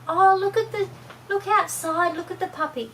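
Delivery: put-on very high, eager kid voice pointing outside; distracting child. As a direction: try to change the subject like a sly toddler. Child tries distracting the parent.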